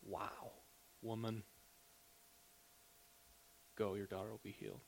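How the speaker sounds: hushed, soft and tender; softly voiced Jesus marvelling; tender amazement. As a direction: breathe it out in quiet wonder. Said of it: "And Jesus goes".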